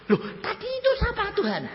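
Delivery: very high astonished question; re-enacted squeak of disbelief; dumbfounded. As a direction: ask in baffled amazement. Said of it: "saya melongo".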